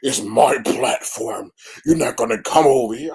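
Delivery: lightly voiced pastor, slow and loud; a hint of the pastor laying down the law; mock pastor. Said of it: "Jay Wilson troll come up here and diss you".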